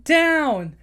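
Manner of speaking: high, loud command to swim; rally the whole crowd of fish; rallying shout. Retold or imitated. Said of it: "go like".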